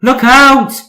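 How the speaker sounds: high loud panicked warning; warning whistle. Acted. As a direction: shout a warning to clear the way. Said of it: "he whistled".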